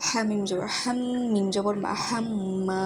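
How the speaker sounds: হাম্মা with the m hummed through the nose; nasal gunnah. Shown as a demonstration. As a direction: hold the ম in হাম্মা as a long nasal hum. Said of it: "এখানে মিম গুণ হবে".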